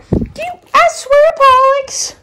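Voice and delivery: exasperated pet voice. Real, not acted. Exasperated at dog.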